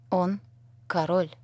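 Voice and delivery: clear, separated words; said distinctly. A narrator's reading. Heroine tells her friend who he is, distinctly. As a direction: set each word apart with a pause. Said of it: "сказала чётко".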